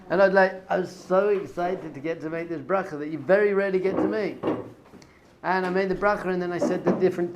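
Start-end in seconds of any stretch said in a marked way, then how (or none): none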